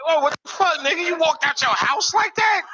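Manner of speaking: high-pitched mock outrage at the smell; shocked disbelief. Comedian shocked at a smelly fan, high mock shout. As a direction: yelp it up high like you can't believe it.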